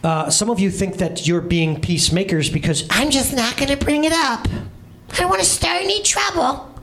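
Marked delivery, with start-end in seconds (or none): 2.9-6.7 high whiny mock voice; conflict-avoider impression. Preacher mocks people who avoid trouble, high and whiny. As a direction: mimic the timid person dodging conflict. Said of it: "It's a good impression, right?".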